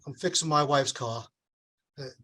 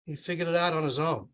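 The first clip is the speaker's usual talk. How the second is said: usual speaking voice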